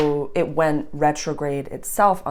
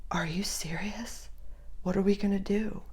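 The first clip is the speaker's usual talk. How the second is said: hushed disbelief; 4 semitones higher, 9 dB quieter than usual. Retold or imitated. Staff reacting when the boss left, hushed disbelief. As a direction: whisper it, stunned.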